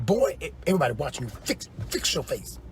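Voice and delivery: fast, then whispered urging; hushed warning. Retold or imitated. Friend at church warns him to fix his face, whispered. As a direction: hiss it under your breath to him. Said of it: "She said".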